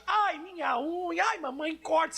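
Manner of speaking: put-on high, slow whining little kid; whiny child. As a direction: beg mommy to cut your nail. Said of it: "Ou você acorda de manhã assim". (criança manhosa e aguda pedindo para a mãe)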